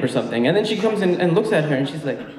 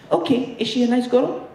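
put-on high, accented mother asking; Egyptian mom voice; 9 semitones higher than usual. Mom asking son about girlfriend, high accented voice.

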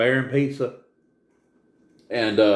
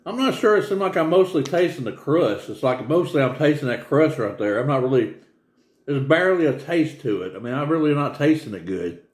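chewing while talking; talking between bites; mouth full; 3 semitones higher than usual. Real, not acted. Seen 2: holds pizza throughout, bites at 3.6-4.2, talks between chews.

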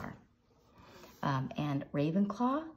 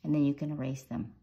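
ordinary talk, nothing marked

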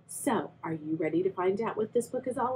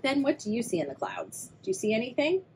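ordinary delivery, nothing marked

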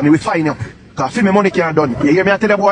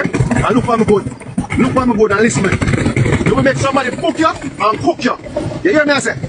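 rising threat; 6 semitones higher than usual. Real, not acted.

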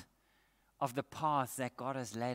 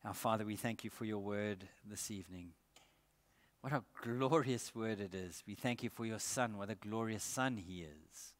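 soft, lower prayer voice; 4 semitones lower, 5 dB quieter than usual. Real, not acted. Preacher praying over the congregation, soft and reverent. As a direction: pray quietly and reverently.

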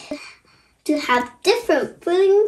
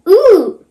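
high, louder "ooh" for the crayon; crayon's "ooh"; 3 semitones higher, 6 dB louder than usual. Retold or imitated. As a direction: voice a loud little ooh. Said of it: "yellow is saying".

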